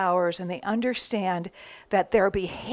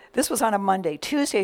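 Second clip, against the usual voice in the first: ordinary delivery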